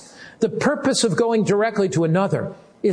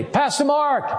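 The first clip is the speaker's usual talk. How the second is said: retold call up; 8 semitones higher, slower than usual. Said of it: "he yelled down at me".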